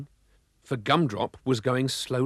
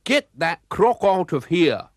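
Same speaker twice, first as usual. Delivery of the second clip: slow, loud snarl, a little higher; 5 semitones higher, 7 dB louder, slower than usual. A narrator's reading. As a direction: growl it at them, slowly. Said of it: "snarled McClurk".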